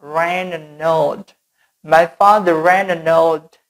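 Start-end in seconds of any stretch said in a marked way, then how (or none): 0.0-1.4 slow linking demo. Shown as a demonstration. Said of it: "N ke old juga bisa disambung".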